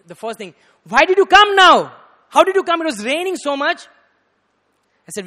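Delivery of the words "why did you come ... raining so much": loud, high scolding outburst; acted-out furious questioning at high pitch; angry guru. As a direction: demand it like an angry teacher. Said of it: "got more angry".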